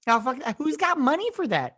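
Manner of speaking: high, loud scoff. Real, not acted. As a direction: brush it off, incredulous. Scoffs at theater idea.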